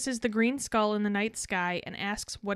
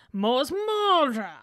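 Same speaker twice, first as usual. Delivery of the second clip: drawn-out mock yell, pitch raised; yelled spell; 4 semitones higher, 3 dB louder, much slower than usual. A narrator's reading. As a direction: stretch the incantation into a slow, theatrical cry. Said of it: "and yells".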